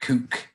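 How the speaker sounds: "Kook" with a long oo; own regional pronunciation. Shown as a demonstration. Co-host showing the correct way to say cook, long oo. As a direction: say "Kook" with an oo as in boot. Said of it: "the correct way to pronounce them".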